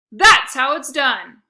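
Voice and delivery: loud, slow coach yell. A narrator's reading. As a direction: call it out proudly. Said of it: "yelled Coach Drago".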